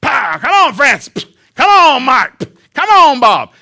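booming, high-pitched driving yell; calling out to a mule. Retold or imitated. Farmer drives his mule with the reins, shouting. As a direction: bellow each name like cracking the reins. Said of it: "called him four different names".

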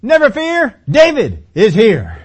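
loud, high superhero proclamation; heroic cartoon cry. David reassures Saul like a cartoon hero, loud and high. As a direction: announce like a cartoon hero arriving. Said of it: "this is what David is saying".

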